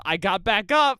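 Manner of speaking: high, loud mock announcement; revived villain. Co-host voices the killer simply returning, mock triumphant. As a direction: blurt it out as a comic comeback. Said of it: "Or just".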